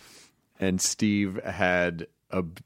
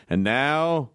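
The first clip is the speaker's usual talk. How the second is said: higher-pitched, drawn-out hype announcer; mock announcer; 9 semitones higher than usual. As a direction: stretch it out like a rally promo. Mock announcer bit about friend.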